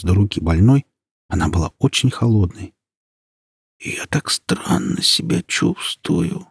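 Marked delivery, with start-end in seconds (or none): 3.8-6.5 put-on high, slow, soft old whisper; old woman whispering. A narrator's reading. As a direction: whisper it like a sick grandmother. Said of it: "прошептала бабушка".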